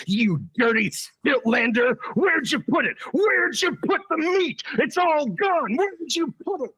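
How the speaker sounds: high-pitched frantic accusation; accusing shout. Acted. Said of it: "points at her accusingly and says".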